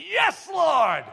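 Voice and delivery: high shouted yes, springing out; eager shout. Acted. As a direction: leap out with a joyful cry. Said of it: "as a lion spring forth to say".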